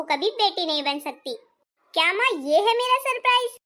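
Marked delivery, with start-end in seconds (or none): 1.9-3.6 higher, startled disbelief; dismayed surprise. Acted. Daughter sees mother's surprise gift, higher-pitched dismay. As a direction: react as if the gift is shocking.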